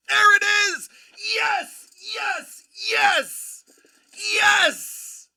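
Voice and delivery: real victory yells, high and loud; triumphant shouting. Real, not acted. Host cheering a winning pick, loud triumphant yells. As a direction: shout it out like you just won.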